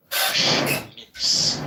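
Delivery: "Gush Mints" in loud, hissing sibilants; hissy echo of a lisp on "Mints". Real, not acted. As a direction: exaggerate the lispy "sh" and "s" in "Gush Mints". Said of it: "There's, like, an echo of a lisp".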